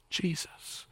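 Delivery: breath-soft, whispered name; hushed. Acted. Pastor tells congregation it's always about Jesus, hushed. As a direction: let the name fall to a hush.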